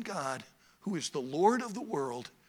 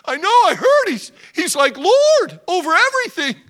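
high, loud eager father; excited old father; 14 semitones higher, 13 dB louder than usual. Acted. Father interrupting Judah, high and excited. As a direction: cut the son off with joy.

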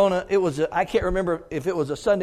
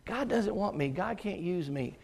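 quiet self-doubt; 11 dB quieter than usual. Retold or imitated. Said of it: "what I kept coming away from saying".

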